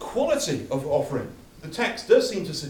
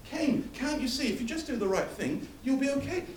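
raised pitch, tender coaxing as God; gentle pleading God; 9 semitones higher than usual. Acted. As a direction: plead gently with a sulking son. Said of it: "he comes to Cain".

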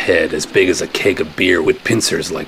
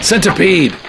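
high loud alarmed cry; 11 semitones higher, 4 dB louder than usual. Acted. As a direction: yell out what you see. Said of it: "I cried".

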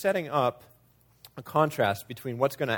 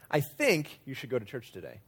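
"think" pitched up, the rest falling away; stress on THINK; 6 dB quieter than usual. Shown as a demonstration. Preacher showing the emphasis game, stress on "think". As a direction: hit the word "think". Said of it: "change the emphasis of the words".

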